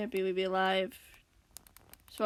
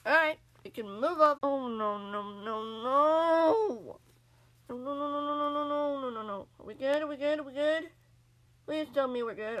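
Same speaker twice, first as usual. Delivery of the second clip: panicked; 5 semitones higher, 6 dB louder than usual. Real, not acted. Stream may have crashed, panicking.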